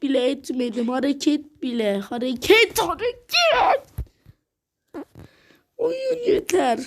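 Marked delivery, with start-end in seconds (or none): 2.8-6.8 high, drawn-out despairing wail; anguished wail. Real, not acted. Gamer wailing at the game that reset him. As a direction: howl it like the game broke you. Raging after losing progress.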